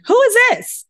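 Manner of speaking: very high, loud sing-song greeting; bright high-pitched phone hello; chirpy phone voice. Retold or imitated. Her chirpy greeting to operators.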